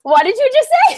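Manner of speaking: high, loud, incredulous demand; shocked, confrontational pitch jump; indignant. Retold or imitated. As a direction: re-enact being scandalized. Retold outrage at his slip.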